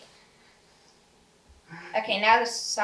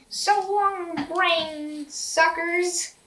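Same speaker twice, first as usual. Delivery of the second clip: high, drawn-out slow mock farewell; goofy cartoon villain; 6 semitones higher, much slower than usual. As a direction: stretch it out like a hammy villain.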